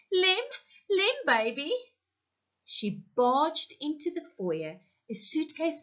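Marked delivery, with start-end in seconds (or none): 0.1-1.7 wailing. A narrator's reading. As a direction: wail his name across the street. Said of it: "wailing at the top of her lungs".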